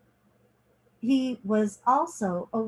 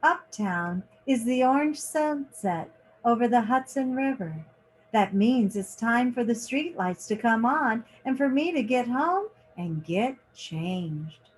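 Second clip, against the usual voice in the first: lively picture-book reading, lingering on each page; reading to children. A narrator's reading. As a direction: read it like story time for kids. Reads picture book aloud.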